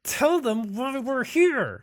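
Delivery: high, slow, drawn-out insistence; exasperated urging. Acted. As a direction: press a reluctant companion, stretching the words. Urging reluctant companion to speak up.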